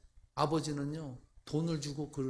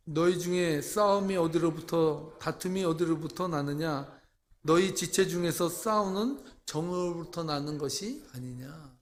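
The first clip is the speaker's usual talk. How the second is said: scripture reading; 6 dB louder than usual. Acted. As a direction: read it out like Scripture before the flock. Reads scripture aloud to congregation. (엄숙한 성경 봉독)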